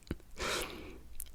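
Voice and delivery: a soft laugh through tears; teary whispered laugh. Real, not acted. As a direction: laugh it off while choked up.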